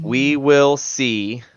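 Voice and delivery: very slow, deliberate delivery; real slow. Shown as a demonstration. Co-host asks for a slow repeat for people in the back, real slow. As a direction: spell it out slowly, word by word. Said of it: "real slow for the people in the back".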